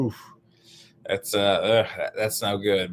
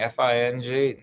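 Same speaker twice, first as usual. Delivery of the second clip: ordinary delivery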